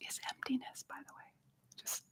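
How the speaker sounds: whispered aside, as if sharing a secret; hushed, playful insider tip; secretive whisper. Pretend. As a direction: drop to a confiding whisper. Aside letting them in on secret.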